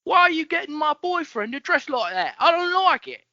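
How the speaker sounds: very high, squawking Cockney complaint; badly faked Cockney, loud and shrieky; hammed-up Cockney girl telling someone off; Eliza Doolittle. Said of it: "talks like Eliza Doolittle".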